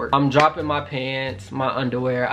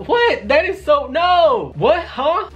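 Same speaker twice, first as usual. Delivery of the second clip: high-pitched, indignant squawk; outraged disbelief; 12 semitones higher, 4 dB louder, slower than usual. Retold or imitated. Retelling his refusal to the hookup, outraged disbelief. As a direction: burst out in hammed-up disbelief.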